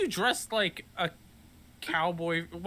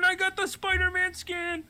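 put-on kid voice; 10 semitones higher than usual. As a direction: repeat it as a whiny kid. Imitating a Fortnite kid.

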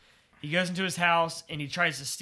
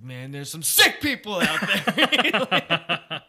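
quoted movie line; 4 dB louder, much slower than usual. Retold or imitated. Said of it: "And he's like".

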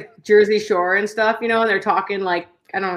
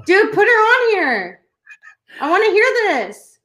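eager demand; 8 semitones higher than usual. Real, not acted. Podcaster begging co-host to put her on, eager. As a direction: beg for it, pitch soaring and words stretched.

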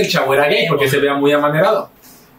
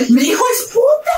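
very high-pitched mock shriek; hammed-up parental horror; shocked outburst. Parent reacts to son's coming out, mock shocked shriek.

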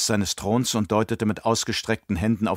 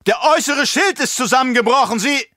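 loud alarmed shout; 13 semitones higher, 5 dB louder than usual. Acted. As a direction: yell a warning across the hall.

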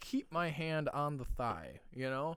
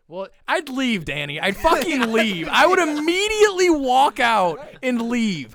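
outraged yelling; 7 semitones higher, 16 dB louder than usual. Real, not acted. Outraged answer to the story.

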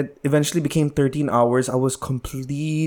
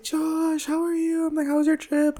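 high mock mom voice, much higher; squeaky motherly greeting, pitched way up; imitating mom. Son mimics mom greeting him at the airport, high mock voice.

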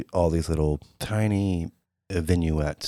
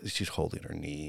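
ordinary talk

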